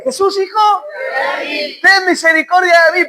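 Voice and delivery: high, insistent shout for mercy; crying out. Acted. Blind man begging Jesus for mercy, crying out. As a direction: keep calling over the objections. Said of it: "clamaba más".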